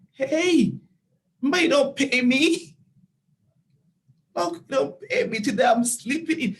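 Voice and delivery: high, loud, indignant retort; defiant comeback. Retold or imitated. Unpaid volunteer to boss who complains she's late, defiant.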